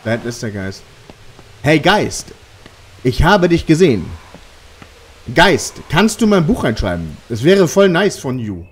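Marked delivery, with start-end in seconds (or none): 1.6-8.7 high, loud call to the ghost; yelling across the room. Real, not acted. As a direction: shout it into the empty room. (laut in den Raum rufen)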